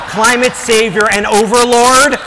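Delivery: hammed-up evangelist climax; mock altar call. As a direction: boom it like a revival preacher.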